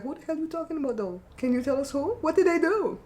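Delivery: nosy questioning. Retold or imitated. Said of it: "put a face like".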